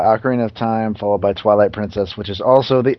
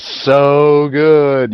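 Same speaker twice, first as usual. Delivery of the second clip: drawn-out; 4 semitones higher, 5 dB louder than usual. Acted. Drawn-out praise.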